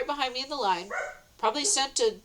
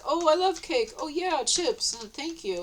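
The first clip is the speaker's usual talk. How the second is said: being nice; 6 semitones higher than usual. Retold or imitated. Showing how she took cake and chips, nice and happy. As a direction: gush sweetly, then thank them gently. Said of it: "And I was being nice".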